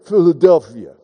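low, slurred boxer's "Philadelphia"; Rocky. Acted. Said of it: "Or as Rocky pronounces it".

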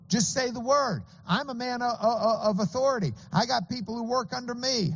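pleading centurion. Retold or imitated. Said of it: "the centurion says".

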